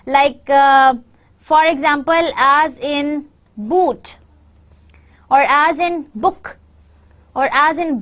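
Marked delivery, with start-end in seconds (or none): 3.6-4.2 "boot" with long oo, drawn out; double O sound. Shown as a demonstration. Teacher demonstrates double O to learners, long oo in "boot". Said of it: "interpretations of one sound". 6.1-6.6 "book" with short rounded u; double O sound. Shown as a demonstration. Teacher demonstrates double O to learners, short u in "book". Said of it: "interpretations of one sound".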